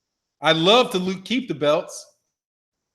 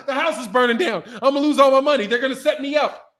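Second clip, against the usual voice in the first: mock panic; 6 semitones higher than usual. Acts out someone fleeing a fire.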